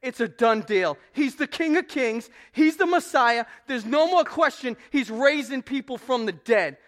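high, loud proclaiming; loud excited crowd declaring the Messiah; crowd's proclamation. Acted. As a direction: announce it like a cheering crowd. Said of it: "the crowd officially says".

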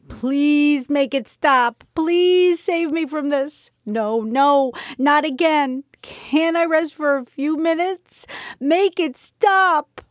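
high, drawn-out wailing plea; slow, high cry of misery; anguished pleading. A narrator's reading. Desperate pleading as sickness returns.

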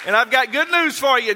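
loud, high rallying preacher voice; preaching at full force. Acted. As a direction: fire the church up, shout it out. Preacher exhorts congregation at full force.

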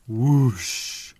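breathy whoosh of wings. A narrator's reading. Dragon's wings again, breathy whoosh. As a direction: whoosh like a flapping wing. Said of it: "It sounded like a huge bird flapping its wings".

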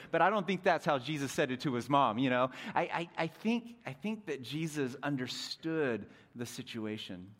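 ordinary talk

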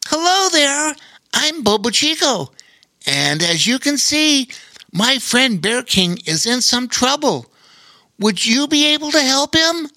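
a small friendly animal asking a favour; friendly otter voice. Acted. Otter character asks spider for help.

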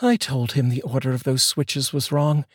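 high female voice, muttered aside; wife grumbling to herself. Acted. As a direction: grumble it to yourself. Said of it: "Ariana said to herself".

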